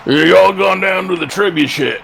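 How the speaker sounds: high, hammed-up American "y'all" voice; mock American accent. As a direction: put on an exaggerated American accent, pitched well up. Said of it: "sounds like an American pronunciation".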